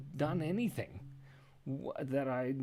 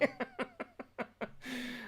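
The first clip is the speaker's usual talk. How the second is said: real high, soft giggle; high trailing laughter; laughing; 12 semitones higher, 6 dB quieter than usual. Real, not acted. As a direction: giggle at the misunderstanding. Amused by ticked off list.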